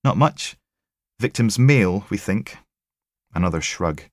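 dry sympathy from the tech; wry female tech. Acted. Tech telling Logan about the sick pathologist, wry.

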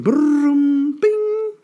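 high, loud rolled whirr and ping; app sound effect. Acted. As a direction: imitate a spinning app landing on its result. Imitates app's sound effect.

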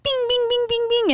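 high, light bouncing "bing" noises; ping pong sound. Retold or imitated. Mom describing her hyper son, ping pong noises. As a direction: bounce out quick high bings.

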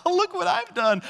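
put-on high toddler voice, very pleased with himself; proud toddler. Toddler on the lazy Susan showing off to parents, high proud voice.

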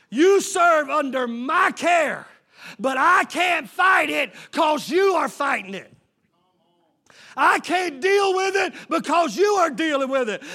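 loud, climbing pulpit shout; full-voiced call and response; fiery preaching. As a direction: drive it home to the congregation, building. Climax of sermon, shouting Amen.

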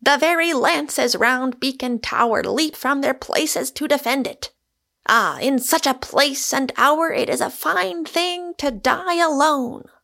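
high, mock-heroic declamation; grandly theatrical last stand; exultant cry. A narrator's reading. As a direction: proclaim it with rapturous bravado, pitch well up. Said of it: "he cried".

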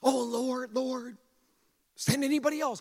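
mock pleading. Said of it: "speaking to him like he's not".

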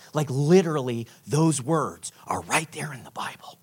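low, whispery confiding voice; almost whisper it, very low; hushed disbelief. Retold or imitated. Friend still revealing the verse.

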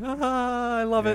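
high, happy sigh of delight. Retold or imitated. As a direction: swoon over it with joy. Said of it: "I'm just like".

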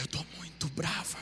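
mom quietly. Retold or imitated. Interpreter voices mom's anger, quietly. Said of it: "Ela falava assim".

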